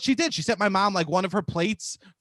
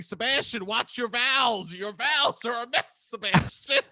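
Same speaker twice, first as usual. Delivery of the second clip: imitation of an exasperated crew yelling; mock screamed scolding; 6 semitones higher than usual. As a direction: drag out the scolding, pitched up. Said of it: "they just would scream as we were shooting".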